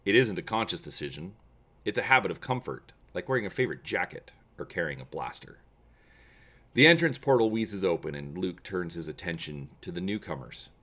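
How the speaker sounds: steady, measured storyteller read; story narration. A narrator's reading. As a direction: read the scene like an audiobook. Reads story part one.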